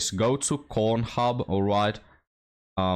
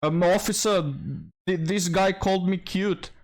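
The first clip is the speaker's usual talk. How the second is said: high, whiny mock complaint to the police; a hammed-up, higher-pitched whine to an officer; mocking woman voice; 9 semitones higher than usual. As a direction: parody of the woman reporting him, high and whiny. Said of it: "she'll ring the police".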